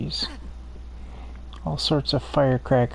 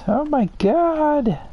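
alarmed oh my God; 12 semitones higher, slower than usual. Real, not acted. Creature about to eat face.

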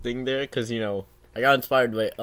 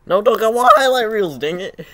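loud, high exasperated yell; frustrated at missing highlight reels; frustrated shout; 9 semitones higher, 10 dB louder than usual. Real, not acted. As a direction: yell it at the screen. Frustrated at miss.

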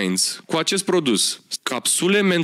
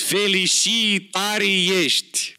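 Moldovan accent; 4 semitones higher, slower than usual. Shown as a demonstration. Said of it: "am înțeles".

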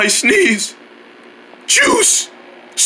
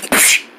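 pretend sneezing fit; 3 semitones higher than usual. Wrinkle Head can't stop, pretend sneezing fit. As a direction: fire off little achoos one after another.

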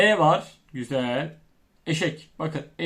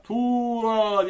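higher, long drawn-out "Tuğra"; mock calling out; 4 semitones higher than usual. Shown as a demonstration. Teacher pretending to call a pupil named Tuğra, mock yell. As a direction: call the name as if across a distance. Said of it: "diye bağırıyorsun".

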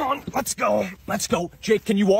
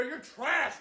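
ordinary talk

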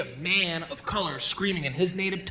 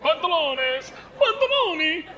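high, exaggerated Spanish-Italian accent; mock Mediterranean accent; 10 semitones higher, 3 dB louder than usual. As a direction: ham up Spanish, then Italian, pitched high. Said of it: "like Italian and Spanish".

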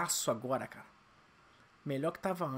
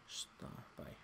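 whispered; 19 dB quieter than usual. Real, not acted. Whispered aside.

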